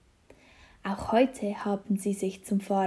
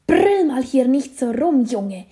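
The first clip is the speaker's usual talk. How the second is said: loud, irritable scolding; grouchy neighbour telling off a child; hated grumpy voice; 3 semitones higher, 8 dB louder than usual. A narrator's reading. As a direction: scold the boy harshly. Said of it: "hört Felix eine verhasste Stimme".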